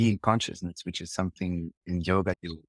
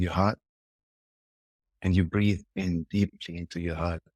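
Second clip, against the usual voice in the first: guided meditation. Shown as a demonstration. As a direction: guide the listener gently into the heart. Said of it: "like a little meditation to finish with".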